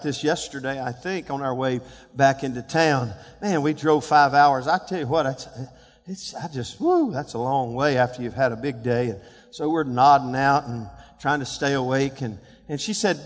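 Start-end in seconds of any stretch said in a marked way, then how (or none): none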